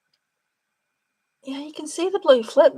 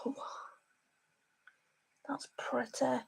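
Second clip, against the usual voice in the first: whisper; 14 dB quieter than usual. Real, not acted. Admiring eyeshadow swatch on camera.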